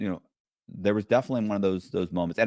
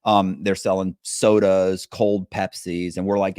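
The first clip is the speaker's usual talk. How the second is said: ordinary delivery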